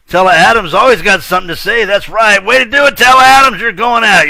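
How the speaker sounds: loud and high, cheering the name; excited shout-out. Real, not acted. Host loudly cheers a listener's post, pitched high. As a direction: hype up Tella Adams.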